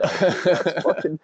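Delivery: real laughter breaking through slow words; real giggles swallowing the line; laughing. Real, not acted. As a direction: barely get the words out for laughing. Cracking up at a funny comment.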